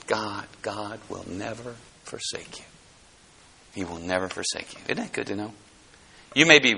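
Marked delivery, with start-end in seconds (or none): 0.1-4.8 tender hush. Acted. Tender reassurance to the hurting listener.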